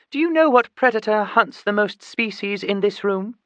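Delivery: put-on high-pitched, educated accent for a chimpanzee.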